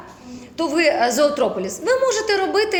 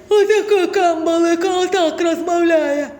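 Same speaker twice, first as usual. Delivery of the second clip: higher, louder, laughing fish voice, slowing at the end; cartoon flounder voice; 4 semitones higher, 5 dB louder than usual. Teacher retells how a colleague voiced the flounder, cartoonish and laughing. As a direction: ham up a silly cartoon flounder. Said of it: "колега додумалася зробити".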